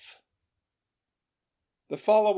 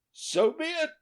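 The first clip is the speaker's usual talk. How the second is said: high, light king's reply; royal assent. A narrator's reading. King graciously accepts the refusal of payment. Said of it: "answered his majesty".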